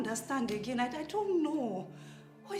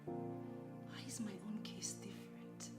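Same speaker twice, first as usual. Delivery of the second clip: quiet despair; 8 semitones lower, 13 dB quieter than usual. Acted. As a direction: murmur it to yourself, heartbroken. Alone, despairing over her marriage.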